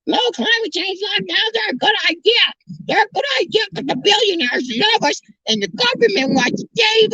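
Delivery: very high, mocking falsetto rant; mock the gullible in squeaky falsetto; sarcastic believer.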